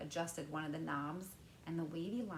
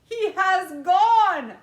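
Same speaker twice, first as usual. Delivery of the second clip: slow, very loud shocked shout; father's shout; 12 semitones higher, 20 dB louder, much slower than usual. A narrator's reading. Father shouting as his son disappears, very loud and slow. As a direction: shout it out in disbelief. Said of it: "shouted Mister T V".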